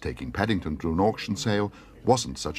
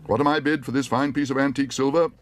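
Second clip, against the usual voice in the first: high, louder calling for bids; auctioneer; 6 semitones higher, 4 dB louder than usual. Acted. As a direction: call out to the hall.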